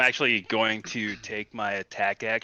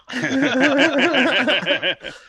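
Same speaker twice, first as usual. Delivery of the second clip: loud laugh; 8 dB louder than usual. Real, not acted. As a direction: burst of high laughter. Big shared laugh.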